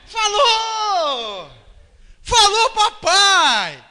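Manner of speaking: loud, high, drawn-out cheer; overjoyed shout. Acted. Father celebrating the baby's first papai, jubilant shout. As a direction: explode with a proud parent's joy.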